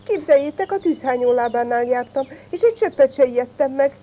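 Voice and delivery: high, excited little show-off; bragging. A narrator's reading. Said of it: "s el akart nekik dicsekedni".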